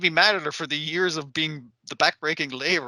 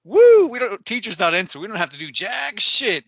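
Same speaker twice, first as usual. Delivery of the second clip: mock cheer that starts loud and high, then settles; whooping students; 6 semitones higher, 7 dB louder than usual. Students celebrating the teacher's absence, whooping loudly then settling.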